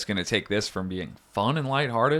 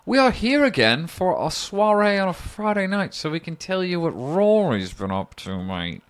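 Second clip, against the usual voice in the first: high, hammed-up grandfather voice; Dick Gilmore; 7 semitones higher than usual. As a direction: ham it up as a mock-grand grandpapa. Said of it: "I would say their grandfather".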